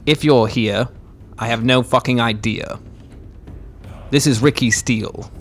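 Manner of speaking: loud calling out into the dark; shouting. Acted. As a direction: yell it into the cave. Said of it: "and just shout".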